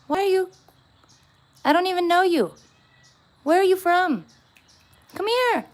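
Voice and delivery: high coaxing voice for a cat; pet talk. Acted. Talking to a stray cat at her door, high coaxing pet voice.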